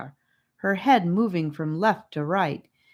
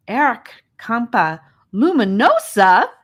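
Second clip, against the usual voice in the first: put-on higher and louder, a boy blurting a fact; eager answer; 6 semitones higher, 9 dB louder than usual. A narrator's reading. Charlie shows off the glowworms' name, eager and loud. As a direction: blurt the Latin name proudly. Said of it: "answered Charlie".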